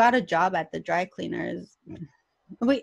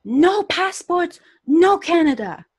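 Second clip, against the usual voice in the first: slow, loud and higher, pressing each word; hammed-up exasperated border guard; frustrated officer; 10 semitones higher, 8 dB louder than usual. Immigration officer losing patience with mom, loud and slow. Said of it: "now he gets frustrated".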